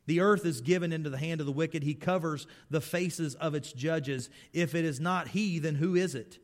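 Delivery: loud, soaring preaching of scripture; preaching Job. Acted. Preacher voicing Job's lament to the church, full force.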